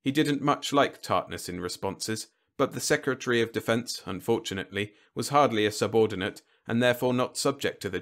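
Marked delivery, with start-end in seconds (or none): none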